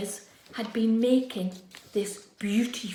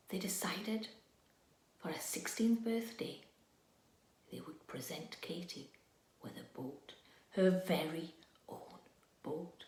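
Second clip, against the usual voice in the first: soft, secretive whisper, much quieter; whispered surprise reveal; hushed secret; 5 semitones lower, 9 dB quieter than usual. A narrator's reading. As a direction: share it like a secret. Secret surprise being told.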